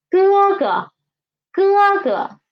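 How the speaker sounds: teacher's model 哥哥, second 哥 falling away lightly. Shown as a demonstration. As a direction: hold first 哥 high, drop the second. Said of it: "It's lower".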